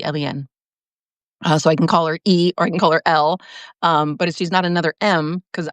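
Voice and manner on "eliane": "Eliane" with its accented E, said low and soft; quiet, lower demonstration of the name "Eliane". Shown as a demonstration. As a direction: pronounce "Eliane" carefully, accent on the E. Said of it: "accent on the E".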